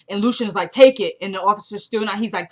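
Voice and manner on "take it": sharp command. Retold or imitated. Lucian ordering the shot.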